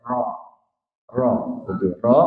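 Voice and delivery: soft "ro" with a brief tremble; light rolled r. Shown as a demonstration. Teacher showing a restrained vibration, soft light "ro". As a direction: keep the roll in "ro" short and thin. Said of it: "nggak terlalu berlebihan".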